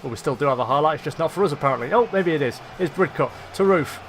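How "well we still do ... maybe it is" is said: ordinary talk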